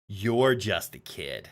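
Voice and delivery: dry, unimpressed repeat; deadpan aside. Real, not acted. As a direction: flatly state the obvious.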